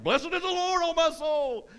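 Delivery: high, loud hammed-up jubilation; exultant praise. David up on the mountaintop, exultant high shout. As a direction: belt it out high in mock rapture. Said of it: "or he's like".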